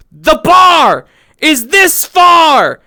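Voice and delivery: high, loud, slow stretched shout; mock outrage shout. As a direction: ham up the outrage. Exaggerated outrage at VR economy.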